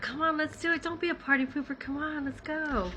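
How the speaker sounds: put-on egging her on like a party girl; pushy girls. Sorority-style girls urging her not to be a party pooper, mock teasing.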